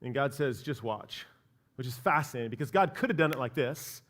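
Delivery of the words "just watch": God's voice. Retold or imitated. God reassures a doubting Moses, low and quiet. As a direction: lower and softer, a hint of God.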